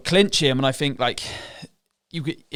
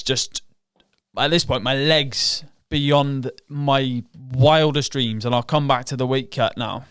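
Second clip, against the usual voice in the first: usual speaking voice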